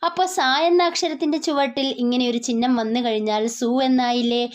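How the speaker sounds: ordinary delivery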